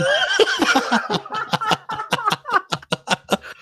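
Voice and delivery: long high-pitched laughter. Real, not acted. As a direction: cackle with delight. Laughs at the gaffe.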